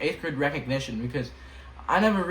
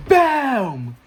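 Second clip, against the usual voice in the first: loud shout; 10 semitones higher, 11 dB louder than usual. Pretend. Hype shout for Jay.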